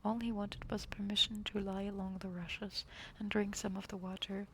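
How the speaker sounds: ordinary delivery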